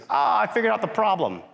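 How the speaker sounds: high, smug mock instructor; knowing instructor. Instructor Pepper teasing the officer, high and smug. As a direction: act like you solved it. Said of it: "He goes".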